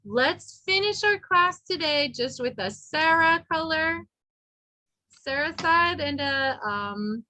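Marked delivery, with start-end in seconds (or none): none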